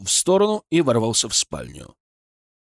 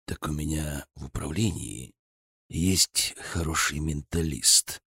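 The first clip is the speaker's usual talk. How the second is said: lower, much softer, slow and menacing; through his teeth; 9 semitones lower, 7 dB quieter, slower than usual. A narrator's reading. Colonel threatens the merchant through gritted teeth. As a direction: grind the threat out quietly. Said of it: "процедил Никита Михайлович".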